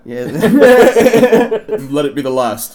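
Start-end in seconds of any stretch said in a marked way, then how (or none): 0.3-1.8 loud, high burst of laughter; real cackle at own joke. Real, not acted. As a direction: let out a big delighted laugh.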